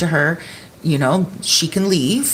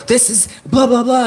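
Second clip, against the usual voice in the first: mock panicked babble, pitched up; higher-pitched retold panic; freaking out; 6 semitones higher than usual. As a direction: imitate someone starting to freak out in a higher voice. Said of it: "he starts freaking out".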